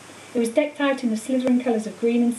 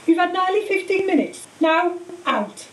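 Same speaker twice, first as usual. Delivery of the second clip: firm, higher-pitched matron; 8 semitones higher than usual. A narrator's reading. Madame Pomfrey ordering visitors out, firm. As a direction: send them off with no argument. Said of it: "she said firmly".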